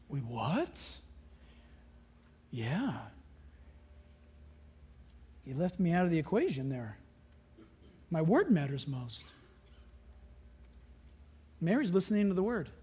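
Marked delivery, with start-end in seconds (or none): 0.1-9.2 low, quiet gentle answer; soft gentle reply. Retold or imitated. Jesus gently correcting Martha, quiet. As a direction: say it softly to Martha.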